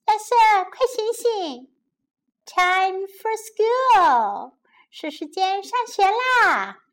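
put-on high, bright child voice, slow on time for school; 小女孩的声音; little sister voice. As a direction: sing it out like a small girl. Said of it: "朵拉说".

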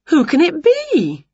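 high, lightly voiced questioning witch; curious witch character. A narrator's reading. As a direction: wonder aloud as a storybook witch. Winnie voiced in story, curious.

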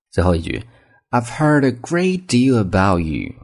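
slow, high, friendly model lines for copying; slow model reading. Shown as a demonstration. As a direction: read each line slowly for students to imitate. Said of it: "读慢一些，大家体会这种语气". (放慢语速，示范语气给大家模仿)

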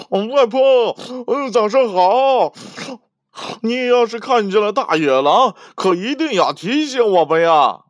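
cheerful shout. Acted. Youngest piglet warning grandma about the wolf, cheerful high shout. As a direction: sing out happily while running past. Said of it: "小猪弟弟爱欢快地喊着".

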